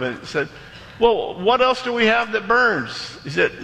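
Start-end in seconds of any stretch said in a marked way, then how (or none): 1.0-3.2 high-pitched, urgent question; acted-out exasperated captain; desperate captain. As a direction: cast about frantically for an answer.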